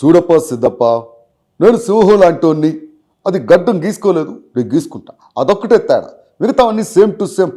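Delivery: swaggering film-hero dialogue, loud and proud; Pawan Kalyan impression. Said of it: "Pawan Kalyan గారిది చే- చేయగలను".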